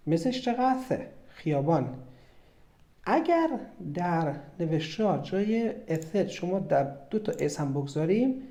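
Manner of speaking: ordinary talk, nothing marked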